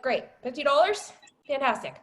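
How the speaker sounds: high-pitched mock delight; retold fury, sarcastic. Woman reacts to fifty dollar paycheck, sarcastic and annoyed. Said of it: "I was furious".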